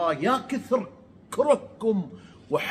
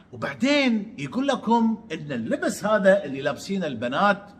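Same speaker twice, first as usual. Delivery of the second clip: usual speaking voice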